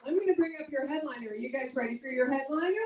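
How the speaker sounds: normal talking voice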